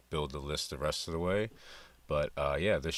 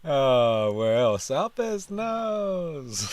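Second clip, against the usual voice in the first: louder, higher, with the last words stretched out slowly; delighted echo; 11 semitones higher, 10 dB louder, much slower than usual. Real, not acted. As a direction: belt the punchline back, savouring it. Host repeats the clip's punchline.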